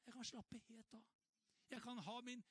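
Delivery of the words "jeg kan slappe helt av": softer relaxed sigh of relief; relieved calm. Real, not acted. Preacher relieved from the stress of performing, softer and calm. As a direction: let the tension go.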